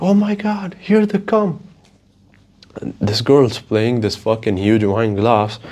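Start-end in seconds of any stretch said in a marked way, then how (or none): 0.0-1.6 high, airy imitation of an excited girl; hammed-up high girly gasp; mock girly voice. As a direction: squeal it like a starstruck influencer. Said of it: "And she's like".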